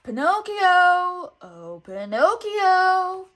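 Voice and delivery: loud, high, slow drawn-out call; coaxing loud call, stretched out; sing-song call. A narrator's reading. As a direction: lure him over with a long call. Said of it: "called Honest John".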